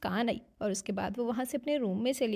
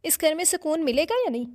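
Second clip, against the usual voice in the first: raised, higher voice of irritated complaint; mild roar; 5 semitones higher, 5 dB louder than usual. A narrator's reading. Man woken from sleep by the row, mild roar. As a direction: bellow it with restraint, as in narration. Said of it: "किसी के धाड़ने की आवाज़ आई".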